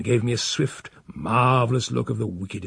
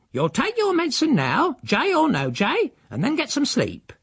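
stern housekeeper; 13 semitones higher than usual. Acted. Stern housekeeper orders him.

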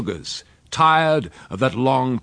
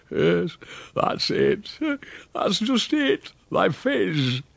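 high, slow rustic squeeze; delighted old countryman; 10 semitones higher, much slower than usual. Acted. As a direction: relish each word, drawn out high. Uncle delightedly seizes on boy's word.

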